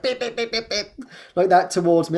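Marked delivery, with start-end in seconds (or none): 0.0-0.9 high mimicked horn beeps; mock car horn. Imitating the driver behind honking at her. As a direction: beep like an impatient car horn. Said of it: "the guy behind was like".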